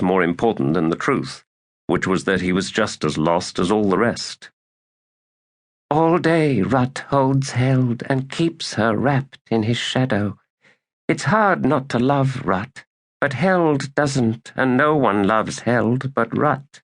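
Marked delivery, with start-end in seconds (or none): 5.9-16.8 put-on high, slow girl's voice, wistful; Badal's voice. A narrator's reading. Badal reflects on Rut and Held, high and slow. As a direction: draw it out slowly in a higher register. Character voice in narration.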